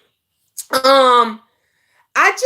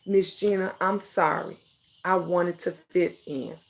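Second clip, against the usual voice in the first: much softer, lower, meek apology; a quiet, lower mock of the apology; quoted apology; 9 semitones lower, 9 dB quieter than usual. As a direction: hush it down like a small confession. Quoting the apology she made.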